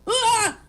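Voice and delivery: a short exasperated cry, pitched high; frustrated yelp. Real, not acted. Overwhelmed baker venting about orders, exasperated cry. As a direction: burst out in frustration.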